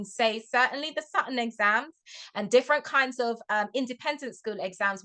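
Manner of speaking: ordinary talk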